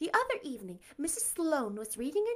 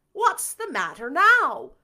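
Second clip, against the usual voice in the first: louder, slow, higher scolding call; sharp call; 7 semitones higher, 11 dB louder, slower than usual. Acted. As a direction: call her name out in alarm. Marilla startled by shriek.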